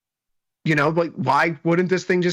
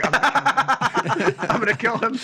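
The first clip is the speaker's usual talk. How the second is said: high, slow, laughing mock threat; mock murderous boss; 8 semitones higher, much slower than usual. Imagined Tom hearing the bullish trade, mock threat. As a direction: drawl a joking threat through a laugh. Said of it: "hear Tom listening to that and going".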